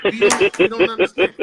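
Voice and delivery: a sudden loud, exasperated burst; really letting the frustration out; getting mad. Real, not acted. Preacher venting at church folk, angry loud outburst. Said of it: "I'm done 'cause I'm getting mad".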